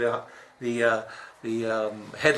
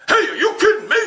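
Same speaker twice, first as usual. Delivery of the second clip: high yelled hey, are you kidding me; mock ref complaint; 4 dB louder than usual. Assistant coach yelling at the referee, high mock outrage. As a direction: bark it at the ref from the bench. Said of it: "Like that".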